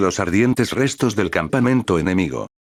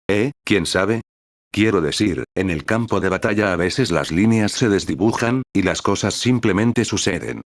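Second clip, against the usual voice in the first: plain reading voice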